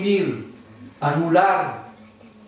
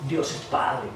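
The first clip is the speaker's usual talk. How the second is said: lowered, solemn "Dios es Padre"; reverent "Dios es Padre"; 5 semitones lower, 5 dB quieter, slower than usual. Retold or imitated. Preacher quotes an idolater: solemn declaration. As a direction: say "Dios es Padre" with a grave, falling close. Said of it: "decía".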